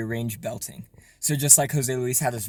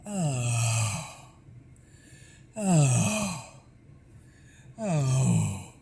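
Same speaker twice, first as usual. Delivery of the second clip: breathy release into air; much slower than usual. Shown as a demonstration. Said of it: "turning it into nothing but air".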